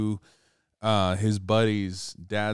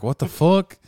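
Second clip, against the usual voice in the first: shocked; 8 semitones higher, 7 dB louder than usual. Retold or imitated. Retold campers shocked.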